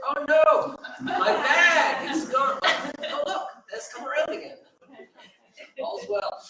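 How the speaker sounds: high, loud, laughing mock panic then relief; mock traveller at the carousel. Poet mimics a traveller spotting his bag, high and laughing. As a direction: squeal about the lost bag, then sigh with relief. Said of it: "and you say".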